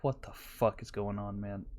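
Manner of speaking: hushed mock confusion; half-voiced stunned dude; imagined baffled driver. Driver watching the Kia rev, hushed baffled. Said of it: "those dudes were like".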